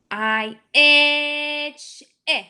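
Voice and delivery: "I, itch" stretched out; teacher tests a vowel; short i sound. Shown as a demonstration. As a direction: draw out the short i. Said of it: "doesn't match".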